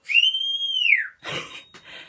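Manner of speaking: high delighted laugh; excited laugh. Real, not acted. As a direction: burst out laughing with joy. Child listener laughs excitedly.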